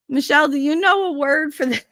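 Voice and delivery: high-pitched imitation of grandma calling over; put-on high voice, asking a favour; grandma asking for help. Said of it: "Ten minutes later".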